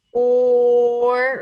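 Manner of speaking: long, loud OR held on one pitch; teacher drawing out the "or" sound; sounded-out pattern. Shown as a demonstration. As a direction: stretch "or" into a steady sustained sound. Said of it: "hear that or pattern".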